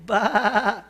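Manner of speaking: loud growled snarl; wolf growl. Retold or imitated. Preacher imitating the wolf's snarl, loud. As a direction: bark it like a wolf. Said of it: "entra un lobo y dice".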